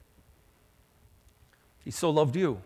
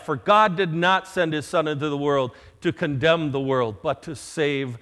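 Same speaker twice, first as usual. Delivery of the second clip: preaching the verse; 6 dB louder than usual. Acted. Preacher reading scripture to the congregation, loud and weighty. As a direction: proclaim it to the whole church. Reads John 3:16 loudly.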